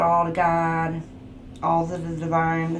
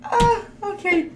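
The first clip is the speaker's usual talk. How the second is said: high-pitched helpless laughing through the words; laughing so hard the voice goes up and cracks; crying with laughter. Real, not acted. Tarot reader cracking up at the viewers' comments, laughing helplessly. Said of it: "I was literally crying, laughing".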